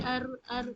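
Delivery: ار ار with a slight trill on the ر; gentle trilled ر; light rolled r. Shown as a demonstration. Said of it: "تھر تھراہٹ والی sound".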